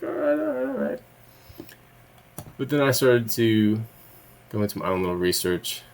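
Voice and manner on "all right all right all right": sarcastic high-pitched brush-off; mock dismissive. Mocking the scientists' explanation.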